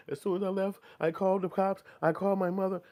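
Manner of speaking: a hammed-up, high, loud frightened witness; mock scared witness. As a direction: pitch it up like a scared man pleading his case. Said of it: "He gotta come at that motherfucker and be like".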